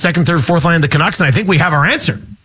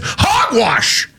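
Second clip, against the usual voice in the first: indignant exclamation; 9 semitones higher than usual. Retold or imitated. Reading a listener text.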